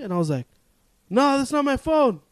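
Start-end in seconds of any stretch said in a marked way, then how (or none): 1.1-2.2 much higher nervous brush-off; high nervous polite exit; flustered brush-off. Retold or imitated. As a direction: get away fast, voice up. Flustered denial to guard.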